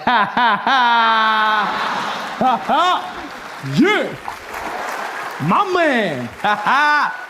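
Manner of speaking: airy, mock-cool laugh; an exaggerated laid-back chuckle.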